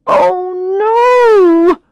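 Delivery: loud, high oh no of panic; realizing she's at the wrong restaurant, dismayed; dismayed cry. Acted. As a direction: cry out as the mistake hits. Narrator realizes her mistake.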